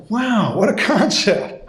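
slow, high-pitched wow with a laugh; mock amazement. Husband answering his wife's obvious suggestion, mock amazement. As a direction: play the revelation up for laughs.

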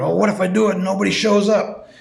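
mildly fretful, pitch lifted; retold paranoid worry. Man afraid nobody will come to his new meeting, mock paranoid worry. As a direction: replay a worried what-if, lightly acted. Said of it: "I was really paranoid".

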